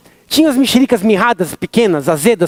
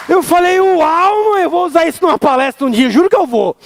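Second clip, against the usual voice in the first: amazed; 9 semitones higher than usual. Retold or imitated. Tells mom he's amazed.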